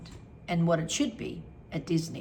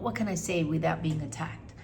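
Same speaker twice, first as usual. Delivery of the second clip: ordinary talk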